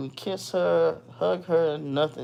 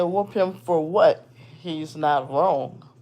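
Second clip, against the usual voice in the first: slow firm reply; 3 dB louder, slower than usual. Retold or imitated.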